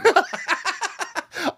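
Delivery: bright burst of laughter; high laugh. Real, not acted. Host laughs at co-host's joke.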